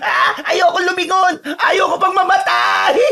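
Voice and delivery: high, wailing cry of panic; tearful shout, drawn out at the end; terrified. Acted. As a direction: wail for your life while running. Terrified friend flees, fearing death.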